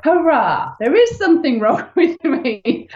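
high, laughing cheer of relief; relieved cheer. Retold or imitated. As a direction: cheer it out, pitched well up. Relief at diagnosis.

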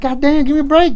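loud, very high-pitched plea; hammed-up cry of exasperation; exasperated. Officer just starting work, swamped by reports, exasperated.

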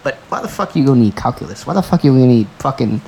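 normal talking voice

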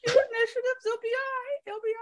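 very high, playful squeal; squeaky falsetto. Pretend. Game master telling players to roll initiative, silly falsetto. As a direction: squeak it out in mock reassurance.

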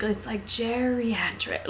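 quiet, slow deadpan line reading. Retold or imitated. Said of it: "she had a fantastic, like, she's like".

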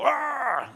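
high, loud mock roar; giant's roar. Preacher voicing Goliath's roar over the valley. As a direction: let out a taunting bellow.

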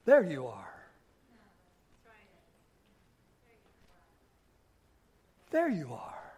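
soft, low, gentle greeting; other-focused person. Acted. Person focused on others entering a room, soft and gentle. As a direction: drop right down and greet them softly. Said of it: "other kind of person enters a room".

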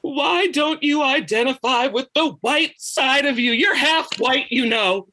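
high, drawn-out mock whimper. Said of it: "she starts kind of whimpering".

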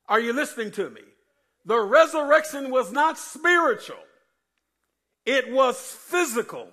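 high, loud pulpit preaching; preaching at full force. Acted. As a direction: preach it hard to the church. Preaching resurrection is physical.

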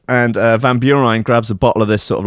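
ordinary talk

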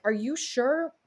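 a hint of a worried husband questioning; husband gently doubting the plan; doubtful husband. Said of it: "my husband was like".

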